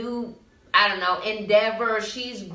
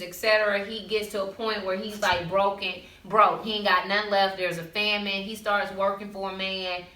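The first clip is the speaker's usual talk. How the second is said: usual speaking voice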